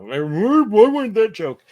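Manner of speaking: high, loud whiny protest; exaggerated indignant griping, imitating someone; mock complaint. Rider complaining to skipper about missing joke, mock indignation.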